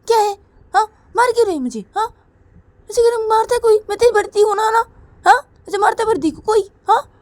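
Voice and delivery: high, loud indignant scolding; big sister scolding her little sister, high and loud. Acted. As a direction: tell her off sharply. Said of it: "और बोलती कि".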